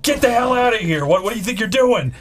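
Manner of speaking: high-pitched mock outrage; retold angry guard. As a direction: imitate a guard ordering trespassers out. Retold guard ordering them out.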